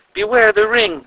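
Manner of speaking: very high, loud far-off ghostly call; distant call. A narrator's reading. A voice warning the guards, calling from afar. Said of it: "a voice called from the distance".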